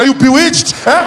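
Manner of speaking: high, loud incredulous question; imitated parent's disbelief; mock baffled parent. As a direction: demand an answer in outraged bewilderment. Parent alarmed at odd crying.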